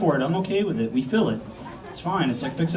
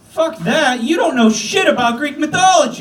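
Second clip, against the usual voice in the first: higher, louder mock snap; mock outburst; 8 semitones higher, 4 dB louder than usual. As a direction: fire back at the nephew. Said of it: "And I was like".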